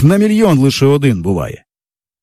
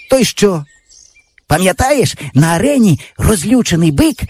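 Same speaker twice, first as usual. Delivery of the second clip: put-on raised, eager boyish voice; excited boy; 6 semitones higher than usual. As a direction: paint the scene for your friend. Yava describes bullfight film. (хлопчина захоплено розповідає про кіно)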